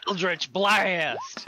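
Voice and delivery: drawn-out, loud battle cry; mock spell shout. Player announces Eldritch Blast in play, loud and drawn out.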